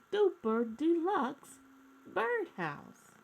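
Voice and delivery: gentle read-aloud storytelling voice; storybook narration. A narrator's reading. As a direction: read it like a bedtime picture book for kids. Reading a picture book aloud.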